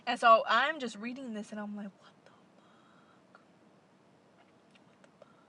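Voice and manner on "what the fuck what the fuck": stunned whisper. Real, not acted. Stunned reading the news.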